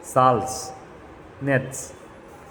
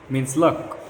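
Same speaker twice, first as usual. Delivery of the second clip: ordinary talk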